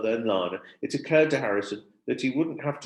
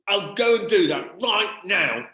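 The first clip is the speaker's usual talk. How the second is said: higher, louder, slow worked-up reply; a bit shouty; 9 semitones higher, 7 dB louder, slower than usual. Acted. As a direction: still worked up, say it too loud. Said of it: "in a bit of a shouty voice".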